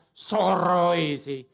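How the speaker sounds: drawn-out, loud whining grumble; sulky grumbling moan; whiny complaint. Pretend. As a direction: moan it slowly like a sulky churchgoer. Mocking a complaining churchgoer.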